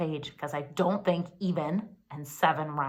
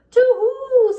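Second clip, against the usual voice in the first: high, loud little owl hoot; little owls hoot back; 12 dB louder than usual. Acted. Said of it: "said the three".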